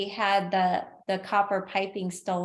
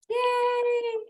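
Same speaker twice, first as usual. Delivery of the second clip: excited cheer. Real, not acted. Cheering colleague at award.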